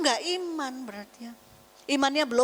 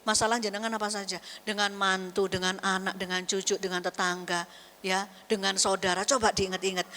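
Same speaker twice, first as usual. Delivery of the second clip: ordinary talk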